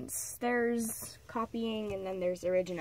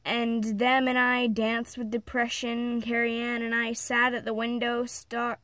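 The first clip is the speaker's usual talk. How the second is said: dramatic recital, leaning on key words; emphatic story reading; 5 dB louder than usual. Acted. Reading of rage at her mother's abuse, dramatic recital. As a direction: declaim a dark confessional poem with weight. Reads own dark story aloud.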